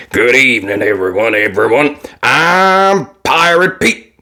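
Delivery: lightly voiced pirate welcoming the crowd; a hint of a stage pirate; pirate showman. A narrator's reading.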